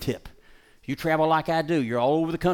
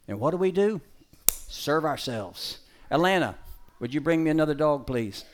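ordinary delivery

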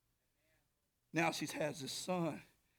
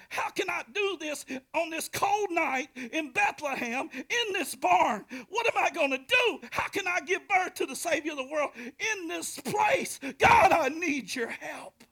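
much higher, louder pleading shout; desperate cry; 12 semitones higher, 9 dB louder than usual. Retold or imitated. Mary crying out in labor.

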